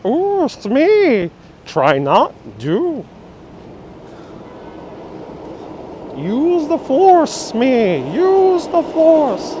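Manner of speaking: high, slow little creature voice; Yoda. Retold or imitated. Star Wars impression for viewers, high slow Yoda. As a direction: squeeze it up high and drawl it slowly.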